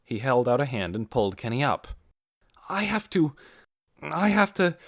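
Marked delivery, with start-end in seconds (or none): 2.7-4.8 breathless higher urgency; urgent and rattled; urgent repetition. A narrator's reading. Doone urgent, distracted.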